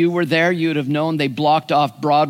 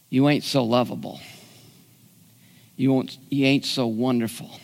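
ordinary talk, nothing marked

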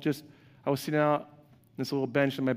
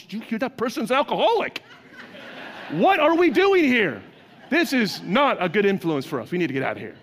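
mock scandalised churchgoer; 6 semitones higher than usual. Mock churchgoer fleeing sinners.

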